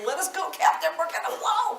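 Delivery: high-pitched comic crew-member cry; straining muscles pleading. Acted. Teacher voicing overworked throat muscles to their captain, comic panicked plea. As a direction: plead high, climbing toward bursting point. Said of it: "muscles can't take that much pressure".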